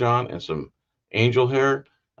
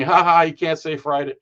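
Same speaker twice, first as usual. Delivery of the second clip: higher, teasing spoken ha-ha; mocking amusement; 5 semitones higher, 4 dB louder than usual. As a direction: make fun with a fake laugh. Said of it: "the Greek thought it was funny".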